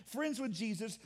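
ordinary talk, nothing marked

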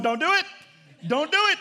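high, loud mock-frantic warning; alarmed plea. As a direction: beg him urgently to stop. Wife pleading on phone.

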